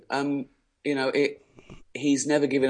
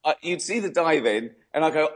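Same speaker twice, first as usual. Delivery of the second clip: usual speaking voice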